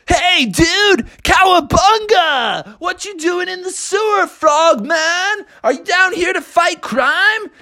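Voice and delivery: high-pitched hyped dude voice; surfer-dude turtle. Acted. Turtles greet the frog in the sewer, hyped. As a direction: hail him with a big cowabunga.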